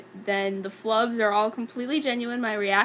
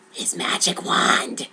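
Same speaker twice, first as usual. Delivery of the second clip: low, slow whispered scheming; scheming broom; 4 semitones lower, slower than usual. Acted. The broom plotting against Harry, whispered. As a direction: plot it under your breath. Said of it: "thought the Bromine".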